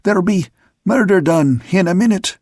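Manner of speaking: high, winded warning; breathless high cry, broken up; catching breath. A narrator's reading. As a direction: warn urgently between breaths. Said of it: "catching his breath between the words".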